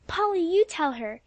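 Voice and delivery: high, eager plea; eager cry. A narrator's reading. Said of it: "cried Alexia".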